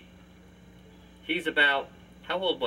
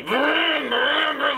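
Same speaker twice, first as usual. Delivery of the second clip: mock engine noise, higher; truck vroom; 8 semitones higher, much slower than usual. Uncle imitating the nephew riding the toy truck, engine noise.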